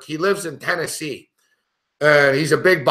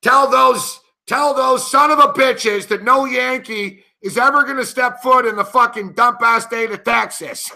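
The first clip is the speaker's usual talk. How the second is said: pitched-up, hammed-up New York rant; George Costanza; 7 semitones higher than usual. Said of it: "think like George Costanza".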